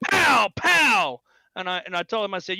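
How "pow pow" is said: high, loud finger-gun pows; mock gunshot noises. Old man miming shots with his hand, loud mock gunfire. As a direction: bark out pretend shots like a kid. He goes.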